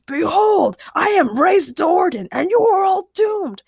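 high, loud grand proclamation; booming villain. A narrator's reading. Armored man announces himself to the friends, booming. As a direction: declaim doom like an arch-villain.

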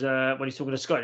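ordinary talk, nothing marked

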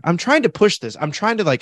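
ordinary talk

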